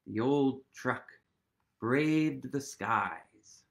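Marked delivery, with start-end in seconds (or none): none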